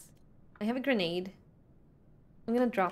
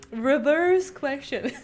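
playful announcement; 4 semitones higher, 6 dB louder, slower than usual. Real, not acted. Announcing a new question.

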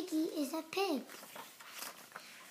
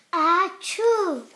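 big acted achoo, louder; loud sneeze; 11 dB louder than usual. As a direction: blast the sneeze out.

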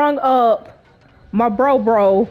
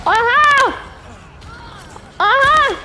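triumphant whoop; 11 semitones higher than usual. Real, not acted. Gamer taunting enemies after a kill, high triumphant whoop. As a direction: crow over the kill.

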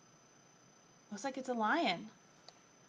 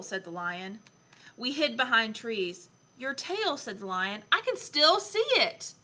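put-on lively, expressive story reading for a young child; picture book reading; 3 dB louder than usual. A narrator's reading. Adult reading Lion Lessons to a child, animated storytelling. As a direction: read it aloud with playful emphasis.

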